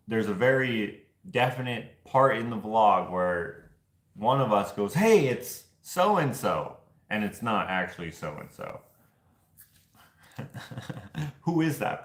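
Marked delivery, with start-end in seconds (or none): 4.9-6.8 higher, drawn-out "hey, spotted someone" call; mock excited call-out. One of the pair jokingly spots a famous person, mock excited call-out. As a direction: call it out slowly, pitched up. Imitates calling out a supposed sighting.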